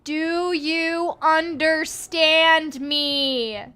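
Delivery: slow, loud, overly clear question. Acted. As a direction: talk loud and slow to a beast. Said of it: "you ask with loud, clear words".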